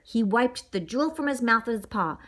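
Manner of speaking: ordinary delivery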